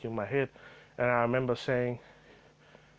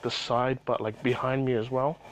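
ordinary delivery

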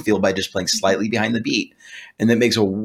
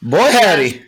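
excited exclamation; 9 semitones higher, 6 dB louder, slower than usual. Real, not acted. Host admiring the restored guitar, a high loud exclamation. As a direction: whoop it out.

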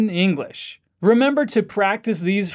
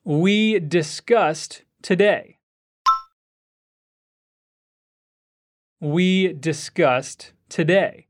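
slow teaching; 3 semitones lower, slower than usual. Shown as a demonstration. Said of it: "Repeat after me".

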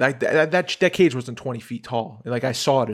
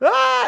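short, high, loud scream; acted-out yell of terror; mock scream; 12 semitones higher, 7 dB louder than usual. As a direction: shriek like a frightened kid. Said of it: "fucking screaming more".

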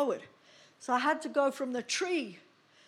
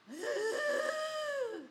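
sharp high fearful gasp; high frightened gasp up a tree; 4 dB quieter than usual. Retold or imitated. Terror stuck up a tree.

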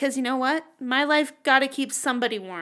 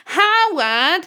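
dramatic Howard; 6 semitones higher, 6 dB louder than usual. Retold or imitated. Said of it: "I'd be like".

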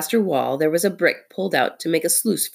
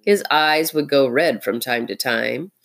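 ordinary delivery, nothing marked

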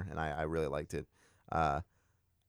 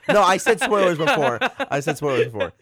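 laughing; 10 semitones higher, 7 dB louder than usual. Real, not acted. Laughing through own spoiler.

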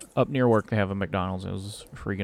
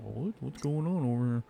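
gawking driver voice, curious and quieter; nosy rubbernecker; 3 semitones higher, 5 dB quieter than usual. Retold or imitated. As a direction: play the nosy gawker slowing down. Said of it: "I'm not gonna be rubbernecking".

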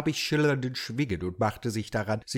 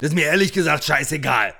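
irritated snap; 4 semitones higher, 5 dB louder, faster than usual. Acted. Holmes snaps at host.

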